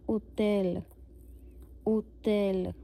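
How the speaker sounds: ओतेल with no h, stress on the end; French hôtel as ओतेल; silent H. Shown as a demonstration. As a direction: say ओतेल, no h, clean French vowels. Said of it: "एच को नहीं फिर से करेंगे pronounce".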